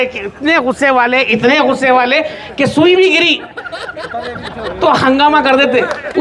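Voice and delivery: ordinary talk, nothing marked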